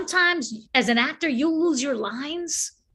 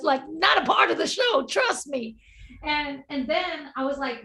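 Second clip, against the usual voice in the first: ordinary talk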